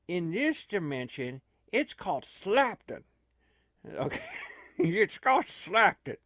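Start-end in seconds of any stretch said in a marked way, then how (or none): 3.9-6.2 higher, amused, laughing through it. Real, not acted. Tickled by the name Slapton, laughing. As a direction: giggle while naming the town.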